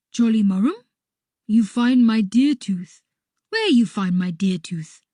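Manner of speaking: loud, astonished character voice; Harby puzzled. A narrator's reading. Puzzled Harby questions the narrator.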